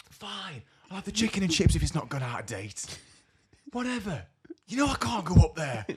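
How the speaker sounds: high, loud huffy mimicry; blown up. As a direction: snap back like a fed-up daughter. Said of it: "that blew up again".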